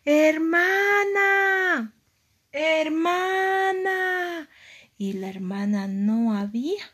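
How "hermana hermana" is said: high, long-drawn call across distance. Acted. Aria searching the ocean for her sister, calling out. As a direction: stretch each word out, high and loud. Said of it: "gritaba en el océano".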